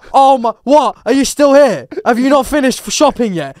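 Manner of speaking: loud, high, incredulous questions; indignant comeback, acted out; exasperated retort. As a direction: raise your voice in disbelief. His exasperated reply to the taunt.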